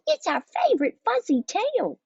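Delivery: put-on very high little-girl excitement; gushing. A narrator's reading. Kitten girls praise their favorite tale, gushing. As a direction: squeak it out adoringly. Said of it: "gushed the girls".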